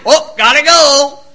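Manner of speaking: lightly hammed-up rushed exit; mock hurry. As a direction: toss it off like you're late. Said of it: "I did not whip out my watch".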